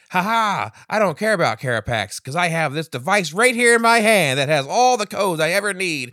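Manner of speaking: loud, high, gloating villain monologue; slow theatrical villain menace. Retold or imitated. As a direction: ham up the evil villain gloat. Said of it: "does the evil villain monologue plan bullshit thing".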